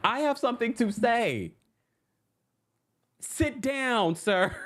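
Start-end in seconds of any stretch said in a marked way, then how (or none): 3.2-4.5 stern command. Retold or imitated. Mock scolding the fan.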